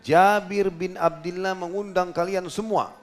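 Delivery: calling out to the crowd. Retold or imitated. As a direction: proclaim it slowly to a thousand men. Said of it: "lalu beliau teriak". (Nabi berseru lantang dan pelan kepada Muhajirin dan Ansar)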